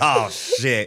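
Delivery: laughing mock dismay. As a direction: swear through laughter. Retold reaction, laughing.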